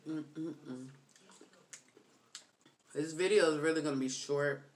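chewing while speaking; mumbling through food; mouth full of crab. Real, not acted. Seen 2: Fingers at mouth eating crab, mouth closed while humming.

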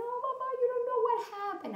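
high, rushed whimper; upset little girl. Acted. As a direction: wail it fast, as if running to mama. Upset girl runs to mother.

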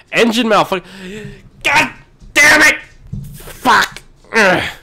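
high, loud swearing fit; angry outburst. Real, not acted. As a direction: yell in sudden frustration at the breakdown. Game engine breaks, driver swears.

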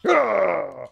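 startled cry sliding down in pitch; frightened cry. Real, not acted. Player hit by a scare in the game, frightened cry. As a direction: cry out in fright, letting it fall.

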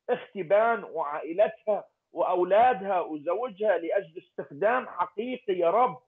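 ordinary delivery

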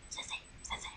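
hushed urgent whisper; urgent whisper to her sister. Retold or imitated. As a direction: whisper her name to get her attention. Nudging her quietly.

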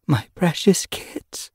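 slow, tender whisper of longing. Acted. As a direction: breathe it out with love. Grieving mother sees her kits.

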